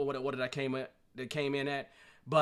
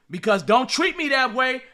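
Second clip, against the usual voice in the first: loud, raised complaint; 9 semitones higher, 10 dB louder than usual. Pretend. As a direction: protest it to the room, already worked up. Mock complaint to audience.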